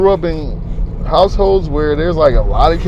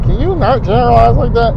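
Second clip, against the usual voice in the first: raised-pitch mimicry of a follower; imitated follower's complaint; 6 semitones higher, 3 dB louder than usual. Follower objecting to his generalizing, imitated in a higher voice. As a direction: lightly mimic a protesting listener, pitched up. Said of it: "she probably said it like that".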